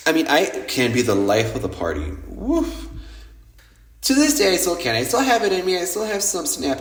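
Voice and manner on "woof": short high "woof"; playful whoop. Real, not acted. Bragging about being the life of the party, high whoop.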